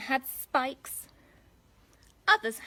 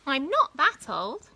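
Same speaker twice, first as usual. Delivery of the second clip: slow indignant snort, louder; huffy grandma, a bit louder and slower; 4 semitones higher, 7 dB louder, slower than usual. Acted. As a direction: snort it out, offended. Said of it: "snorted Grandma".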